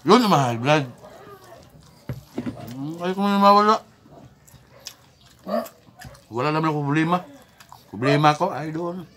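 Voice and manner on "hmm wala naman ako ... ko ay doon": normal talking voice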